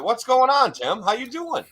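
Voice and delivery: high-pitched, hyped-up hello; excited greeting. Real, not acted. Host greeting his returning radio guest, excited greeting. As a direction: burst into an eager, high welcome.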